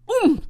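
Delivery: high, loud landing grunt. Acted. Wilbur landing with a thump, loud grunt. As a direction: let out a sharp oomph as you land. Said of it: "he grunted".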